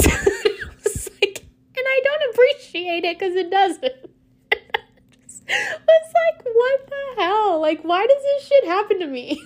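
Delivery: very high, squealing with laughter; incredulous laughing complaint. Real, not acted. Telling Cameron what the driver said, laughing in disbelief. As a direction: wail the complaint through your giggles. Laughs repeating the insult to friend.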